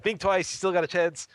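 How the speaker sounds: high playful mock warning, half laughing; joking warning to baby. Father joking to his unborn child, high and playful. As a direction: tease the baby to think twice.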